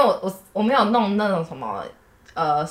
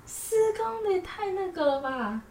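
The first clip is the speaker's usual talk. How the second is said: disbelief; 11 semitones higher, 8 dB quieter, slower than usual. Real, not acted. Protesting the long walk.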